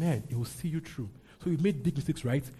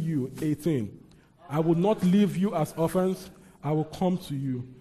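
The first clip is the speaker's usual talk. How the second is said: leading scripture reading; 2 semitones higher, 4 dB louder than usual. Acted. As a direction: read aloud firmly for all to follow. Leads congregation in reading scripture.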